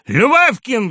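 high, loud summons; sudden loud shout. A narrator's reading. Postmaster calling his clerk, sudden loud shout. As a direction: bark the name across the room. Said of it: "крикнул он так громко".

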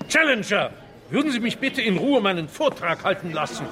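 fast, shouted rebuke; exasperated outburst. Acted. Illingworth shouting at the heckling Challenger. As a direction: lose patience and fire it at him.

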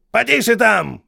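high, loud barked order; shouting sergeant. A narrator's reading. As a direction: bark it at the ranks like a sergeant. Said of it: "крикнул шедший впереди Хейксвилл". (кричащий сержант)

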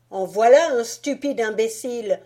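put-on loud scornful boy's outburst; Fritz exclaiming. Fritz scorning the broken nutcracker, loud and scornful. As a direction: bark it with boyish contempt. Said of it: "s'écria celui-ci".

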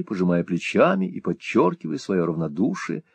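ordinary delivery, nothing marked

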